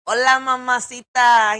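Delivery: a hint of a smooth wrestler greeting; Eddie Guerrero imitation. Streamer imitating the man in the clip, mock charmer greeting. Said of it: "sounding like Eddie Guerrero".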